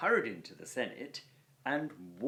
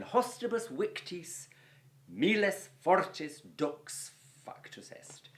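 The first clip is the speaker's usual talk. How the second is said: reading Latin aloud; 8 semitones higher, 5 dB louder, slower than usual. Shown as a demonstration. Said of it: "reads Latin sentence aloud".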